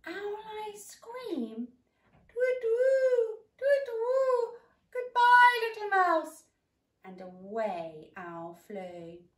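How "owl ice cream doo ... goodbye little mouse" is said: very high, loud hooting owl; very high, very loud frightened owl; panicked owl. Acted. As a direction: hoot in terror and fly.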